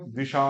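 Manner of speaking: "दिशा" with the final aa stretched out; short di, long shaa in "दिशा"; lengthened final aa. Shown as a demonstration. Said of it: "आ को भी बड़ा बोलेंगे".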